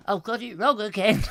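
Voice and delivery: lightly put-on comic character voice; comic catchphrase. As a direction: play the hapless catchphrase. Quotes a comedian's catchphrase.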